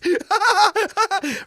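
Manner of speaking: high, loud psycho cackle; maniacal killer laugh. Pretend. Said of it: "That was my impression of a serial killer".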